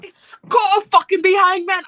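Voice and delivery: loud, high mock British rant; Ospreay impression. Co-host imitating Ospreay, loud British accent. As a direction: shout it like a hammed-up Ospreay. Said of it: "an Ospreay impression".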